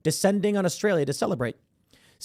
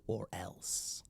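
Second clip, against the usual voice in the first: menacing whisper; 8 semitones lower, 18 dB quieter than usual. Acted. Menacing trailing threat.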